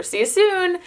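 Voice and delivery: a hint of a little kid; "see you soon" lightly lifted; lightly high toddler voice. Mom explains her daughter's word, a touch high. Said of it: "She says soon now for".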